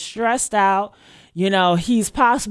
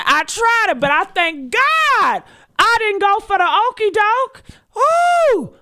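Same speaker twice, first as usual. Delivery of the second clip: rising to a shout; 9 semitones higher, 3 dB louder than usual. Real, not acted. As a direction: voice climbing into a cry-shout. Rant building to a shout.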